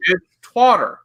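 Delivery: "Twitter" twisted into "Twotter"; pointed mock name "Twotter"; deliberate mispronunciation. Shown as a demonstration. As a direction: hit "Twitter" as "Twotter", with an "ah" vowel. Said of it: "I said on purpose".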